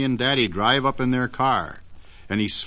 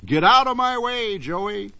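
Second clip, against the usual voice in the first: goose telling off; 8 semitones higher than usual. Acted. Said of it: "as if to say".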